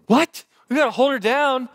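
alarmed disbelief. Retold or imitated. Panicked dad retelling holding daughter.

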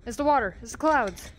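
ordinary talk, nothing marked

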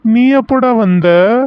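loud surprised call to a friend; startled call. Acted. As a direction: blurt it out, caught off guard and relieved. Friend suddenly appears from building.

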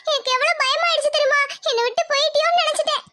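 put-on high child voice, relieved and shaken; a girl greeting her waking mother; tearful relief of a young girl; relieved little girl.

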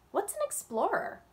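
put-on high, bright child's question; curious little girl. Young Yasmin asking her father a question, high and curious. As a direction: ask it as an eager young girl. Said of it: "asked Yasmin".

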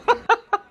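high-pitched burst of real laughter; high giggle. Real, not acted. Laughing at fail clip.

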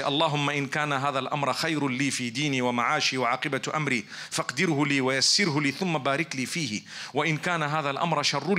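rapid, low recitation of Arabic; very fast. Shown as a demonstration. Preacher running through the istikhara dua, very fast. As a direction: rattle off the supplication at speed. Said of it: "I said it very fast".

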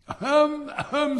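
loud, very high annoyed throat-clearing; angry ahem. Acted. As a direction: cough sharply to cut in, irritated. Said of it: "said the rocket angrily".

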